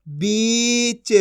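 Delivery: बीच very slow, with a held ī; long drawn-out vowel. Shown as a demonstration. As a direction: stretch बीच into "beee-ch". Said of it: "खींच के पढ़ेंगे".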